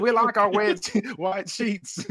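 laughing through a quote. Retold or imitated. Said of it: "He said".

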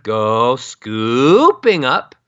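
"scooping" drawn out slowly in a big swoop; exaggerated scooping. Shown as a demonstration. Voice coach shows what to avoid, slowly swooping up on "scooping". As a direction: stretch and slide "scooping" upward. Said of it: "Instead of".

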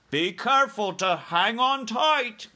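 high, loud giant character voice; BFG giant. Acted. BFG to Sophie riding in his ear, urgent warning. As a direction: call it out as the friendly giant.

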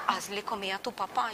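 low, muttered repeat of the order; reluctant echo. Retold or imitated. Daughter repeating God's order to cook for father, reluctant echo. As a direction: repeat it softly, dragging your feet.

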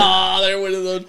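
hyped up, high and loud; mock hype over a small play; frat bro. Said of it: "and you were like".

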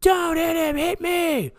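very high, frantic pleading yell; imitated girlfriend yelling. Girlfriend yelling at her boyfriend mid-fight, high frantic yell. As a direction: yell it high, begging him to stop. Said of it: "she's yelling at him like".

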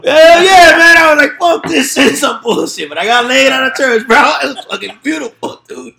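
high loud gleeful bragging; excited loud. Real, not acted. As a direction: crow about it, loud and high. Laughing boast after crude story.

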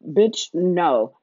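ordinary talk

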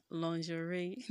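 lingerie as lon-zhuh-RAY, rising at the end; lingerie, "lawn-zhə-ray"; teaching pronunciation. Shown as a demonstration. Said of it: "Say it after me".

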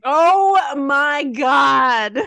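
loud, drawn-out, slow exclamation; real stunned outcry; exclaiming. Real, not acted. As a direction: cry it out in disbelief. Reacts to the grasshopper joke.

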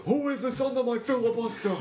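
high, laughing Bernie-like butcher voice; Bill the Butcher voice. Acted. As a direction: growl it like Bill becoming Bernie. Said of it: "Bill the Butcher impression hard enough".

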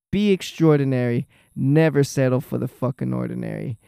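usual speaking voice

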